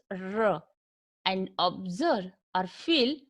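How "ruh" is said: R drawn out as "rruh", tongue curled back; retroflex R demo. Shown as a demonstration. Teacher asks learners to feel the tongue, retroflex R demo. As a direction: sound out R with the tongue curled backward. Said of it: "Try to pronounce R".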